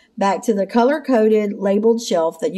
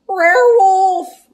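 high, loud cartoon dog cry; slow, howling doggy shout; Scooby-Doo; 10 semitones higher, 7 dB louder, slower than usual. A narrator's reading. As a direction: yelp a drawn-out alarm like a talking dog. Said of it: "Scooby cried".

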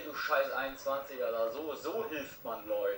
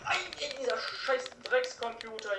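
angry; 7 semitones higher than usual. Real, not acted. Swearing at the game computer.

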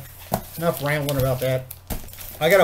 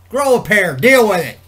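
loud and higher, brushing it off; tough-love shout; 8 semitones higher, 14 dB louder than usual. Real, not acted. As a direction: bark it like a drill sergeant. Dismissing mild injuries, tough talk.

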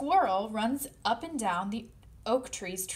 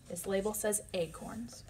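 ordinary delivery

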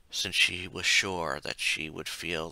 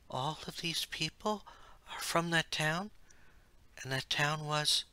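higher, softer questioning maiden; young heroine voice; 8 semitones higher, 4 dB quieter than usual. A narrator's reading. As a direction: ask in quiet disbelief. Celeste asks the oracle.

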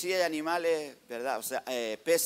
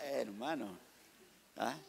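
ordinary talk

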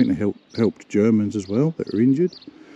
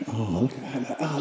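usual speaking voice

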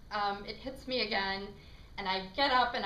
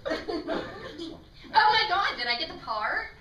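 excited LA accent, high and loud; 9 semitones higher, 7 dB louder, slower than usual. Shown as a demonstration. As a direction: gush in an exaggerated LA accent. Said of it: "the last one we wanna work on".

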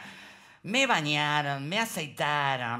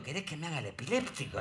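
whispered aside; 3 semitones lower, 7 dB quieter, faster than usual. Retold or imitated. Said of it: "le digo al oído".

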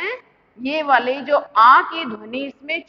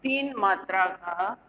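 dictation; 5 semitones lower, 5 dB quieter than usual. Shown as a demonstration. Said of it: "पहले इसकी परिभाषा लिख लो".

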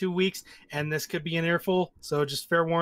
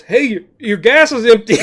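loud, raised mock-friendly call; mock helpful stranger; 5 semitones higher, 13 dB louder than usual. Cohost imitates a gas thief calling out, loud mock cheer. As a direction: play a thief cheerfully warning you. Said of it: "somebody out there going".

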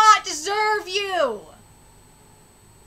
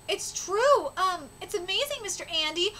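ordinary talk, nothing marked

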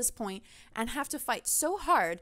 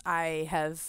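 ordinary delivery

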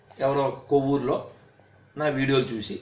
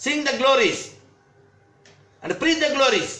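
rousing proclamation; 8 semitones higher, much slower than usual. Acted. Proclaiming a slogan to the audience.

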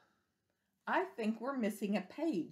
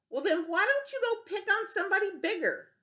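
friendly ladybug; 12 semitones higher than usual. Acted. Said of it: "friendly ladybug replies".

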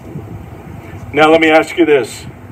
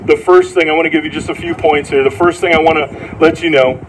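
usual speaking voice

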